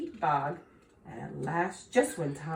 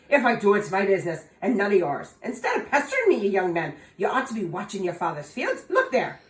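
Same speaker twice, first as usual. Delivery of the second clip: put-on louder, fast, snappish old fairy; sharp leprechaun; 3 semitones higher, 7 dB louder, faster than usual. Leprechaun sharply scolding young Tom, louder and fast. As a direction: snap back to get rid of him. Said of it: "answered Tom sharply".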